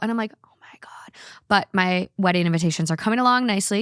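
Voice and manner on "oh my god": hushed whisper. Real, not acted. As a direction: whisper it softly. Whispered aside.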